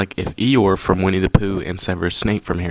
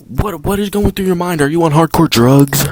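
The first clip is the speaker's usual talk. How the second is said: higher and louder, disbelieving; exasperated rant; 8 semitones higher, 6 dB louder than usual. Real, not acted. Venting to viewers about the teacher's homework, exasperated. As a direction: vent at the absent teacher.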